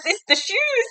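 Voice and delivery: very high mock-panicked squeal; panicked protest. Grover blaming the flying shoes, high panicked squeal. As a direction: squeal the excuse in hammed-up alarm.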